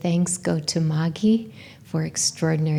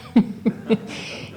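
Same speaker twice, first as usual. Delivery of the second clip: breathy laugh; 4 semitones higher than usual. Real, not acted. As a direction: laugh at the memory. Laughs at ugly suitcase girl.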